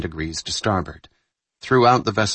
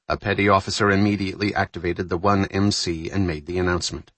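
plain reading voice

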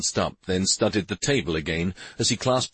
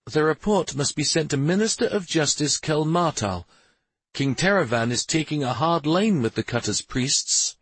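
lightly voiced royal lady, higher pitch; a hint of a lady giving orders; Shari's voice; 8 semitones higher than usual. A narrator's reading. Shari instructing the mayor on his report, lightly voiced and higher.

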